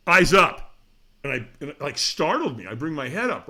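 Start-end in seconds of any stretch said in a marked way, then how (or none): 0.1-0.8 louder, higher, startling call to attention; trainer's sharp command. Retold or imitated. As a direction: imitate a trainer's sudden loud order. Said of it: "all of a sudden, the trainer goes".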